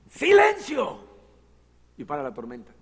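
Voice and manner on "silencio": booming high command to the storm; commanding shout. Acted. Jesus rebuking the storm, booming shout. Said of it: "Le, le dice".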